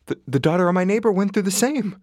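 high, loud, eager example; emphatic insistence. Acted. Nurse convincing his neighbour with an example, emphatically.

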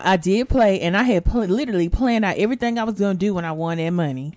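ordinary talk

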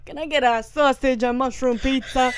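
imitated New Jersey Italian pizza order; Italian New Jersey accent. Retelling a viral video, mild New Jersey Italian accent. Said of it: "speaking of Italian, New Jersey, like, accents".

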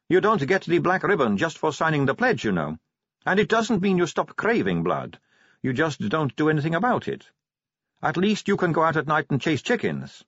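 high-pitched matter-of-fact confession; Sally, candid vampire. Acted. Sally explains vampire abstinence.

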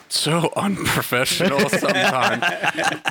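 slow, laughing through it. Real, not acted. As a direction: let the laugh break the word. Laughing at own remark.

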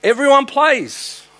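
loud, high, slow proclamation; emphatic shout. Acted. Preacher booming to the congregation, loud and slow. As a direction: call it out to the whole church.

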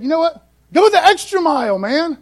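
a hint of a relaxed Jesus giving friendly advice; casual Jesus quote. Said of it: "And Jesus said".

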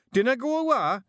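high, loud broad Scots yell; Scottish shout. Acted. Rob calls to hiver.

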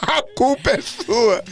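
drawn-out, teasing accusation; slow mock blame. Host blaming his stage director, slow and teasing. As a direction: lay the blame slowly, half joking. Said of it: "ele olhou pra mim e falou".

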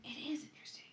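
breathy whispered echo; whispering. Real, not acted. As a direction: drop to a whisper. Echoing in a whisper.

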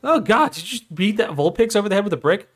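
high, hammed-up disbelief; mock shock. Onlooker seeing a Pokemon bricked, mock appalled. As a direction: react aghast to a cartoon whack.

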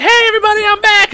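high, bright mock stage voice; mock cheery announcement. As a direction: play her bouncing onstage. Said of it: "She's like".

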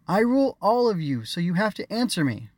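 high, loud bossy demand. A narrator's reading. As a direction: insist like a spoiled queen. Queen demands obedience.

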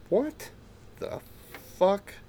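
incredulous high-pitched what the fuck; shocked disbelief. Retold or imitated. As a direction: voice jumps way up on "What", stunned. Retold shocked reaction.

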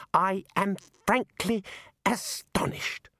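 high, slow, halting disbelief; aghast. Acted. Young wizard upset by his elders, aghast and halting. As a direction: force it out word by word, voice giving way.

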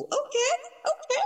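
delighted squealing laugh; excited squeal. Real, not acted. Host thrilled by the news.

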